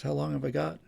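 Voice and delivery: normal talking voice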